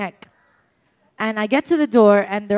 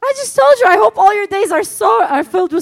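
much higher and louder, blurting it out; loud high exasperation; 9 semitones higher, 7 dB louder than usual. Retold or imitated. Guest repeating her blunder to grieving friend, loud and high. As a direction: shout it high in disbelief at having to repeat it. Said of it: "And I'm like".

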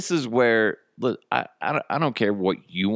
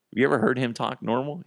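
ordinary delivery

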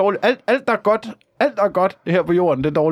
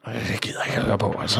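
low, quick, soft grumble; fed-up demon; 6 semitones lower, 7 dB quieter, much faster than usual. Acted. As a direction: drop down and grumble it away. Demon fed up after imitated shouting.

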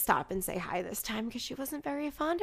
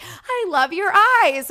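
much higher and much louder, slowed gush; excited gush; 9 semitones higher, 11 dB louder, slower than usual. Retold or imitated. Gushing back at a stranger on the dance floor, loud and high. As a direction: squeal the compliment back with delight. She gushes back at club.

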